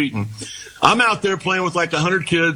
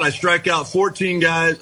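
usual speaking voice

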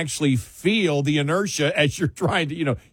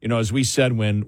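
normal talking voice

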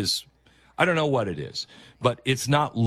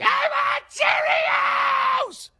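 very high, drawn-out mock wail; imitated toddler tantrum; 3 dB louder, much slower than usual. Imitating a small child in a grocery store, screeching tantrum. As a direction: screech it slowly like a preschooler melting down. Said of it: "go spread eagle on the floor and they're like".

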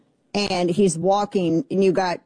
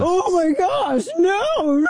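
mock shock; 12 semitones higher, much slower than usual. Host mocking the senator's outrage, hammed-up shocked cry. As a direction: ham up the horror, pitch way up.

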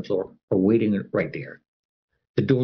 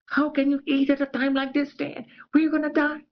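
panicked lady. Retold or imitated. Panicked supervisor voiced in story.

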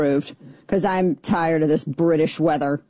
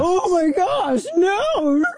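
high, drawn-out wail of fake dismay; mock horror; 12 semitones higher, much slower than usual. Radio host mocking outrage at the beer celebration, high mock-horrified wail. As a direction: wail it in exaggerated shock.

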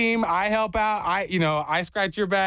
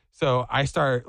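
usual speaking voice

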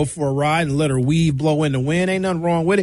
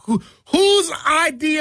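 high, slow mock outrage; 11 semitones higher, slower than usual. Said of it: "why do they let me have a radio show".

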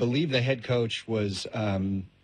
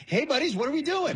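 high, quick, over-eager greeting; chummy stepdad; 13 semitones higher, faster than usual. Acted. Stepdad dropping in on the kid's friends, chummy. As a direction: barge in high and fast like a pally stepfather. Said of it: "you got some friends over, he comes in".

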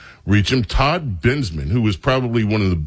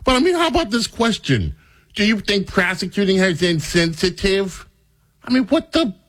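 mock disbelief; 10 semitones higher than usual. Host mocks the question again.

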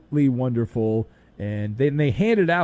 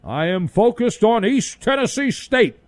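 higher, clipped-off voice of the coach; mock Belichick deflection; 7 semitones higher than usual. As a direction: stonewall the question like a robot captain. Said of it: "Captain Belichick has the Star Trek deflector shields".

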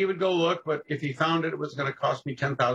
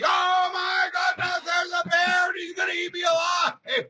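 high frantic yelling, much higher and louder; panicked shout; 3 dB louder than usual. Retold or imitated. Pilot shrieking over the radio about a polar bear.

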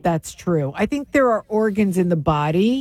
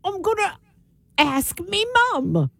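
put-on British child; 9 semitones higher than usual. Child goes to ask mum, mock British accent. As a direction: play a British kid calling mum.

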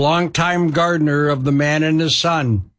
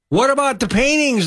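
high, raised outcry; crying out; 9 semitones higher than usual. A narrator's reading. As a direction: demand it in protest. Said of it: "they're crying out".

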